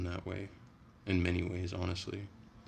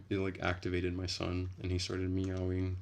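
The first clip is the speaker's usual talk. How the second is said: ordinary talk, nothing marked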